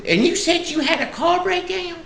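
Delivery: put-on very high old-lady voice, Southern. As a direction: ask it in a high country-granny squeal. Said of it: "sweet little old lady".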